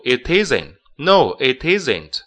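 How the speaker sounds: slow "it isn't" run together; linking it isn't. Shown as a demonstration. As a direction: link "it" and "isn't" slowly. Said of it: "It跟isn't要连读". (慢速连读"it isn't")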